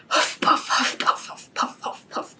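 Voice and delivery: breathy whispered panting, fading away; huffing and puffing. Acted. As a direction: pant like a dog climbing a hill. Dog panting up the hill.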